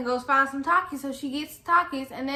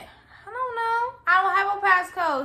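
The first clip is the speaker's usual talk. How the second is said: high, flustered retelling; mock panic, pitched up; freaking out; 6 semitones higher than usual. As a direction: answer in a high, helpless fluster. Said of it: "I'm freaking out already".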